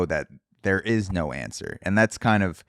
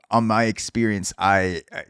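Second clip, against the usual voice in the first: normal talking voice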